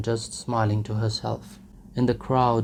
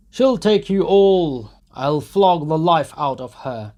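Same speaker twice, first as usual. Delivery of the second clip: furious shout; 7 semitones higher, 7 dB louder than usual. Acted. Mikolka in fury at the mare.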